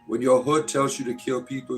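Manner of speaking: ordinary talk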